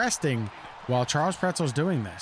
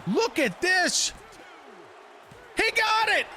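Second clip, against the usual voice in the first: victory shout; slower than usual. Real, not acted. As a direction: announce the winner at full cry. Commentator calls the winner.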